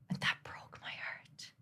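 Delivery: barely voiced whisper, near tears; hushed broken whisper; whispered heartbreak. Real, not acted. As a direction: let it break to a whisper. Emotional confession, whispered.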